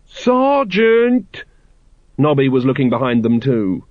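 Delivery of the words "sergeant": very high drawn-out cry of warning; alarmed call. A narrator's reading. Carrot warning Colon of the dragon, alarmed.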